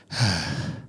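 a short winded breath out; short panting sigh. Acted. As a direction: gasp out a quick tired breath. Catching breath in water.

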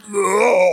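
loud, high mock groan. Mimics the sound someone made.

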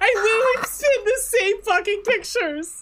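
very high, squealing through laughter; hysterical disbelief. Real, not acted. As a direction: lose it laughing, voice way up. Discovers identical photos.

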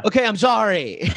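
high, breezy fake apology with a laugh; dismissive sorry. Mocking his own insincere apologies, high and breezy. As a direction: toss off a careless sorry. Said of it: "apologies are very much like".

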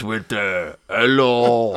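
drawn-out, hammed-up French accent; mock French accent. Imitating a footballer's press conference, mock French accent. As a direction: stretch it like a film Frenchman. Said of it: "in a French accent".

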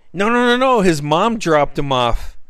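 high, loud jeering crowd voice; teasing stranger's jeer; mocking heckler. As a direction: jeer at him like a drunk in the crowd.

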